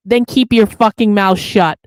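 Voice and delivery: loud angry snap at the chat; angry outburst. Real, not acted. Streamer scolding a complaining chatter, loud angry snap. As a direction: shut him down hard and loud.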